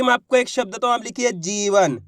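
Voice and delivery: normal talking voice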